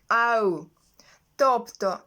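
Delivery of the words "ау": «ау» as ah gliding into oo; OW sound demonstration. Shown as a demonstration. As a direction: model «ау» clearly as one glide. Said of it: "Вони читаються як". (показова вимова «ау»)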